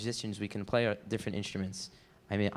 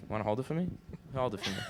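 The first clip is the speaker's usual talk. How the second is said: quiet, gentle coaxing; soft coaxing; 3 semitones higher, 6 dB quieter, faster than usual. Acted. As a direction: softly coax the request. Coaxing him to hold it.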